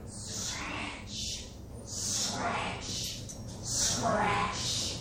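whispered, drawn-out scratching noise; very soft, rasping scratch with long hissed endings; imitated scratching. Storyteller imitating the critter at night, softly scratching. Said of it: "and it went".

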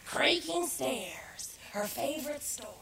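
soft unvoiced whisper. Real, not acted. Narrator whispers the next story title to audience. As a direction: whisper the title as a secret aside. Aside to a listener.

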